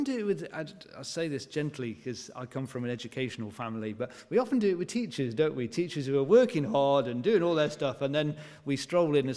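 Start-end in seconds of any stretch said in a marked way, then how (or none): none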